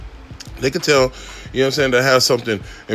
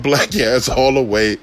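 ordinary talk

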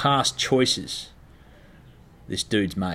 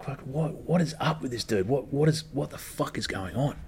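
quietly baffled, talking to himself; puzzled muttering; 4 semitones higher, 6 dB quieter than usual. Retold or imitated. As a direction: mull it over under your breath. Puzzling over the message alone.

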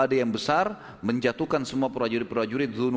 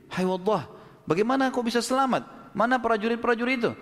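high, astonished calls to Waddah; a crowd member calling out, pitched high in surprise; amazed crowd; 8 semitones higher than usual. Acted. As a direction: ask in high disbelief, like an astonished townsman.

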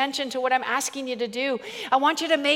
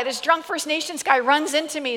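ordinary talk

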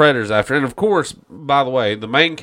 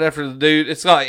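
ordinary talk

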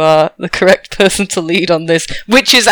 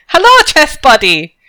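high-pitched eager hello to a pal; excited greeting; 6 semitones higher than usual. Acted. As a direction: greet your chess buddy with glee. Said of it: "He's very excited to see you".

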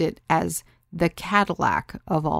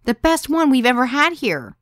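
loud, high-pitched gushing; enthusiastic; 8 semitones higher, 7 dB louder than usual. A narrator's reading. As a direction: rave about her in a high, loud voice. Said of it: "Sarah said enthusiastically".

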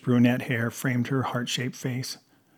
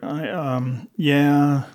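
hesitant, halting stammer; mildly flustered stumble; 3 semitones higher, 6 dB louder, much slower than usual. A narrator's reading. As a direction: fumble for an answer. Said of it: "he stammered".

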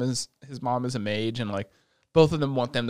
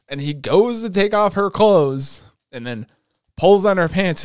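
louder and higher, slowing for the reveal; excited build-up; 7 semitones higher, 6 dB louder than usual. Real, not acted.

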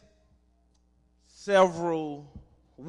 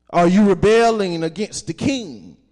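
louder, higher mocking question; mocking challenge; 6 semitones higher, 12 dB louder than usual. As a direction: throw the taunt at them with scorn. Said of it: "they mocked and ridiculed us".

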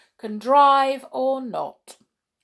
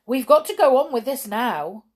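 louder, raised insistence; cross; 5 semitones higher, 7 dB louder than usual. A narrator's reading. Judge overruling the driver, cross and firm. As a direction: crossly shut the matter down. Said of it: "answered the judge crossly".

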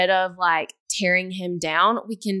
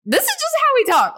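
much higher, louder mock tone; 6 dB louder than usual. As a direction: squeak it out, mocking yourself. Mocking their own tone.

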